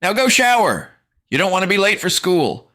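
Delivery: high, loud mother orders; bossy mom. Acted. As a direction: order it like a coach.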